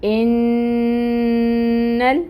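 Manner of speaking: ان ال- drawn very slow, the noon gliding straight into the lam; noon merged into lam. Shown as a demonstration. Teacher showing students how noon joins lam, slow merged recitation. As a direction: join the noon of ان into the ال smoothly and long. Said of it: "اس نون کو ہم اس لام کے ساتھ ملائیں گے".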